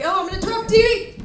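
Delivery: high, loud mock announcement; mimicked excited yell. Daughter rushing to turn off TV, mimicked high yell. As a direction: yell it high, imitating an over-eager kid. Quotes her daughter hurrying about.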